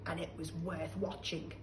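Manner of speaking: usual speaking voice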